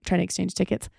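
usual speaking voice

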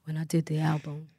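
low, soft [name] voice; [name] impression. Asked what [name] sounds like, low and soft impression. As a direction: drop down low and hush it like [name]. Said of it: "What's [name] sound like?".

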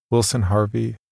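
ordinary talk, nothing marked

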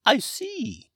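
high, loud dragon character voice; Hamish dragon voice. Acted. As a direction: play a chirpy, high-pitched dragon wizard. Hamish speaks.

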